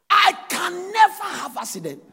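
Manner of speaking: high, loud and slow proclamation; shouted declaration. Acted. Preacher to congregation, shouted faith declaration. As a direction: cry it out like a battle claim.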